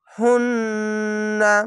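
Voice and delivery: very slow হুন্না with a long held nasal ন্ন; nasal ghunna. Shown as a demonstration. Teacher shows the obligatory ghunna on হুন্না. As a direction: hum through the ন্ন of হুন্না slowly. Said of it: "এখানে ওয়াজিব গুন্না".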